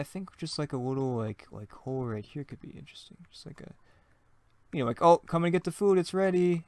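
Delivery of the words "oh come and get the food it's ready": lightly acted call to the table, higher and louder; raised voice, summoning someone to eat; calling to dinner. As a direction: call out that the meal is ready. Acted cook calling spouse to dinner.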